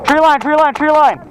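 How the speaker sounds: urgent repeated callout; calling out. Real, not acted. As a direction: shout the position to the team. Calling out enemy position.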